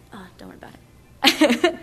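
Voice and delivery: quick slow whisper off to the side; whispered, unhurried aside. Real, not acted. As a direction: brush it off under your breath. Aside to someone offstage.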